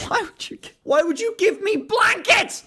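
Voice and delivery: very high, incredulous whine; squeaky, laughing-mad disbelief; exasperated annoyance. Real, not acted. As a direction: plead in baffled frustration. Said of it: "I'm so annoyed".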